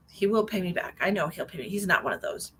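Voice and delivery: usual speaking voice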